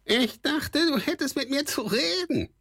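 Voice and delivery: very high, squawking goblin voice; a sly goblin's high squawk; squawky kobold voice. A narrator's reading. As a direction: call out behind him like a squeaky little goblin. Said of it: "hörte er die Quäkstimme des Kobolds".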